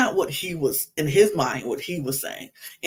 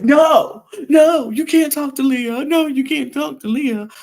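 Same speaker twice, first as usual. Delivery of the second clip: higher, louder pretend shout of no; mock protest; 10 semitones higher, 7 dB louder than usual. Imagining Janae's forbidden reply to Connor, a higher, louder mock shout. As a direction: shout it as the reply she could not give. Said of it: "what is she supposed to say".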